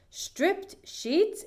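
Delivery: high, startled echo of the words; shocked exclamation. Acted. Mrs. Rogers staring at torn sheets, shocked. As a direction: gasp it back in disbelief. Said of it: "exclaimed Missus Rogers".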